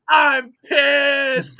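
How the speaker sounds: high, loud retold yell; imitating a freak-out at full volume; imitated scream. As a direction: scream it as the starving contestant. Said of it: "just screaming".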